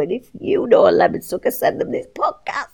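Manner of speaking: high breathy whisper into a laugh; giggly whisper. Real, not acted. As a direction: tease it, then giggle. Playful plug then giggle.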